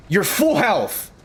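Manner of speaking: slow, high, stretched disbelief; incredulous outburst. Real, not acted. Gamer yelling at a full-health teammate, incredulous. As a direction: drag it out high in outrage. Teammate didn't take damage.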